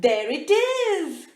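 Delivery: high, loud, drawn-out cry of delight; excited cry. Acted. Hungry mouse spotting cheese, excited cry. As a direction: sing out the find with glee. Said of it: "cried Snappy".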